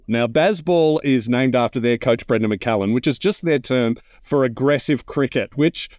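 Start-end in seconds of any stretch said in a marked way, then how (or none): none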